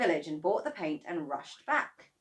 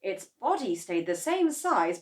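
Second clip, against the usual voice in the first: usual speaking voice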